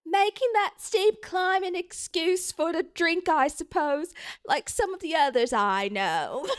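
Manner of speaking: high-voiced housewife. Mrs. Rogers grumbling about drinkers, high comic voice.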